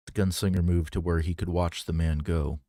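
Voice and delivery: plain reading voice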